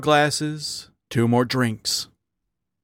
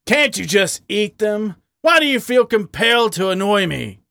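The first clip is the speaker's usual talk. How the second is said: higher-pitched exasperated demand; 9 semitones higher than usual. A narrator's reading. Badger to the partner flicking peanuts at him, demanding. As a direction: demand an answer, thoroughly fed up. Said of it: "Harry demanded".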